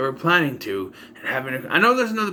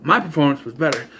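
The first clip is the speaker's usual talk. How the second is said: ordinary talk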